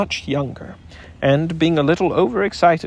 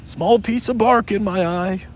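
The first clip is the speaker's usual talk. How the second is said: nervous Tigger; 5 semitones higher than usual. A narrator's reading. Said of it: "said Tigger nervously".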